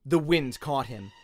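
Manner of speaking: plain reading voice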